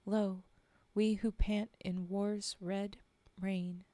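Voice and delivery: measured, earnest poetry reading; solemn verse read aloud; poem recital. Acted. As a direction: read the war poem steadily, letting images land. Reads a war poem aloud.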